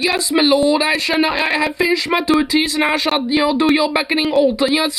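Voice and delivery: high, simpering servant voice, far above his own; mock a bowing lackey answering his lord; groveling servant. Mocking his bosses' expectations in a high simpering servant voice. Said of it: "be a little, like, beckoning little".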